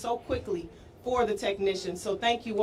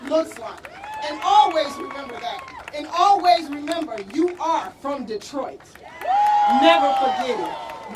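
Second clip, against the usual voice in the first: rallying shout; 4 semitones higher, 9 dB louder than usual. Real, not acted. Rallying the players at ceremony.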